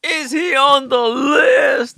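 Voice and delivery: high, slow, loud disbelieving echo; playful shock; mock incredulous. Real, not acted. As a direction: echo the question in amazement.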